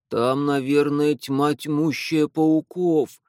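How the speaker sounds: plain reading voice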